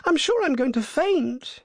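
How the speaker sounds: very high, faint-hearted wail; hammed-up damsel in distress; groaning in fright. A narrator's reading. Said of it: "groaned the other".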